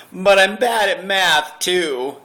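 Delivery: high, loud mock blubbering; mock sobbing wail. Roasting the friend with a loud mock-crying wail. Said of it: "And he said, he started crying even louder".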